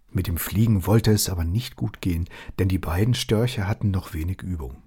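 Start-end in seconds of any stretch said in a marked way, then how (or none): none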